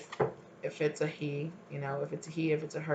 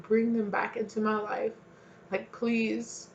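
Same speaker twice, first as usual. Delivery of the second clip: higher, yearning plea to God; longing plea; 5 semitones higher than usual. Acted. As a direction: beg to have them back. Voicing someone longing for them.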